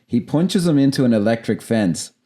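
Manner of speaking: usual speaking voice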